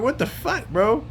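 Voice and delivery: can't believe what you're seeing; incredulous outburst. Retold or imitated. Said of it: "I'm like".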